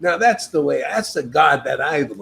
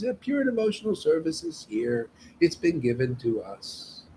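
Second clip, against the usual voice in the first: ordinary talk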